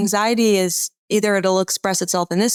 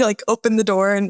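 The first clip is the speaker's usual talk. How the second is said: ordinary talk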